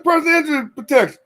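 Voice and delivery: fast and high telling the agent what pip stands for; spelling it out fast. Retold or imitated. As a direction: rattle off the full name. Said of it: "I said".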